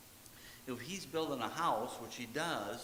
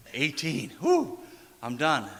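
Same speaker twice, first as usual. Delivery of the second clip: mock relief; 8 dB louder than usual. Voices youngster relieved at turning eighteen.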